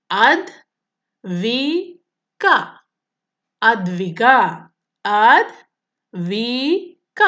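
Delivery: Advika said very slowly to claps; Advika drawn out in three slow beats; clapping syllables. Shown as a demonstration. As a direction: beat out Advika for a class. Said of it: "ഞാൻ മൂന്ന് പ്രാവശ്യം clap ചെയ്യണം".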